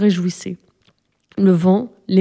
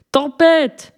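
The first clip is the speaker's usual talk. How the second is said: higher-pitched, understated narrated yell; hurlement d'alerte; 7 semitones higher than usual. A narrator's reading. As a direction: call the alarm like a storybook helmsman. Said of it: "hurla l'homme qui tenait le gouvernail". (cri d'alerte aigu, mais retenu)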